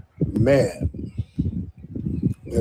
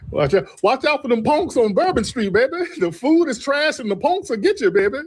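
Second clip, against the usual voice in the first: put-on high, sing-song auntie voice calling everyone baby; much higher, playful mock-scolding local lady; New Orleans local; 10 semitones higher than usual. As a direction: play a sassy New Orleans auntie scolding you away from the Quarter. Auntie voice again.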